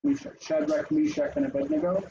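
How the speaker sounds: anglicised names, Meshach with "ee"; English pronunciation. Shown as a demonstration. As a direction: recite Shadrach, Meshach, and Abednego the familiar English way. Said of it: "in English we're used to saying".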